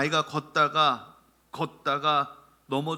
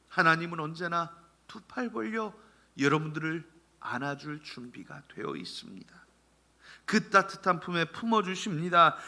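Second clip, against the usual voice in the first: ordinary talk, nothing marked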